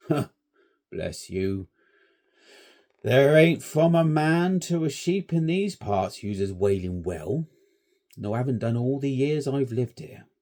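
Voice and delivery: put-on shepherd telling boys nobody uses the well; old shepherd. A narrator's reading. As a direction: chuckle it off like a countryman. Shepherd chuckling answer.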